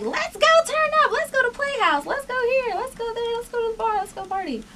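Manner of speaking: high-pitched excited urging, tailing down in volume; eager party pleading. Retold or imitated. Party girl pitching outings to housemates, excited and high-pitched.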